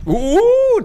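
sudden high-pitched "ooh" burst; high loud whoop. Real, not acted. Loud outburst while telling a story.

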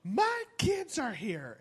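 high, slow, prissy outrage; indignant mother. Retold or imitated. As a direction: scold like an offended mom. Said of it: "she's like".

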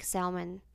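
"salmon" as SAL-mun, low and soft; L pronounced in salmon. Retold or imitated. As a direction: quote "salmon" softly with the L sounded. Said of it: "they were like".

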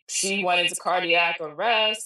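ordinary talk, nothing marked

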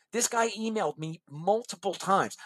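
normal talking voice

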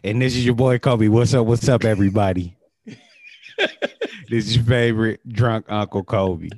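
loud, pumped-up host intro; hype intro. Acted. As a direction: shout out the welcome like a hype man. Cohost's hyped self-introduction.

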